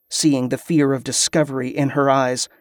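plain reading voice

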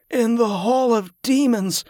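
high, feverish moaning; delirious moan. A narrator's reading. Sick man raving in his wife's arms, high moan. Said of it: "he moaned".

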